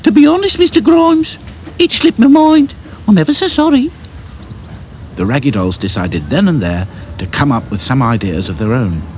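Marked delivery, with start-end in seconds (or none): 0.0-3.9 very high, loud apologetic woman; Florrie's voice. A narrator's reading. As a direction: apologise like a flustered cook. Florrie answers boss.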